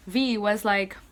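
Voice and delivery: ordinary delivery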